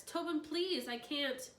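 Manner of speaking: put-on high, begging plea to the boy; plead, breaking off; pleading wild man. A narrator's reading. Wild man begs Tobin not to push, pleading.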